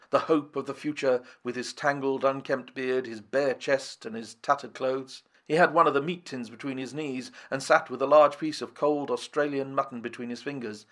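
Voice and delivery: ordinary delivery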